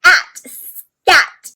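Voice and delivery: scat said loud and high; sounding out scat. Shown as a demonstration. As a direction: sound out the wrong word scat. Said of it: "let's see".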